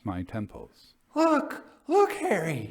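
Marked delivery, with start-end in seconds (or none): 1.2-2.7 high, strained and urgent, much higher pitch; painful eagerness. Acted. Jasper urging Harry to see the ghost: pained, eager, high. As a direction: plead with him to look, aching to be believed. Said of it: "in a tone of painful eagerness".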